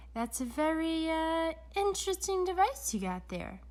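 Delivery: lightly voiced uneasy villain; Doctor Brock, nervous. A narrator's reading.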